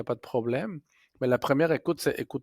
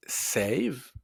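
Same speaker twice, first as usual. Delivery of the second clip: ordinary talk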